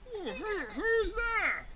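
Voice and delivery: high, slow, stretched question; startled storybook bear. Acted. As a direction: call out who's there from a window. Honey Bear calls out of window.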